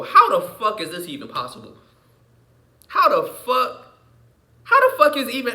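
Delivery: building outrage; rising disbelief. Real, not acted.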